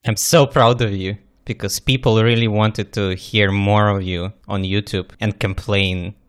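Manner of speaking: ordinary talk, nothing marked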